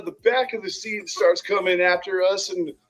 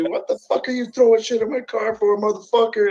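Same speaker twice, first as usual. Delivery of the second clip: retold yell; 6 semitones higher than usual. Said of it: "just starts yelling".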